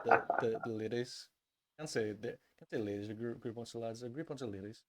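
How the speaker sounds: normal talking voice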